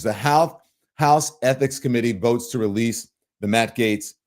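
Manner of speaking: ordinary talk, nothing marked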